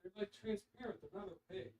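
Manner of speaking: very faint, distant voice; calling from another room. Real, not acted. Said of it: "You're in the wrong room for the microphones".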